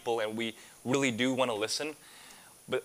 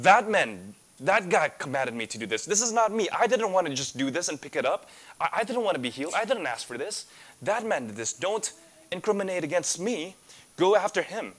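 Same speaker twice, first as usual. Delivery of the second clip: high, louder, blaming someone else; panicked man passing the blame; defensive protest; 6 semitones higher, 4 dB louder than usual. Acted. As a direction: whine that it wasn't your fault, pointing at the other man. Healed man shifting the blame.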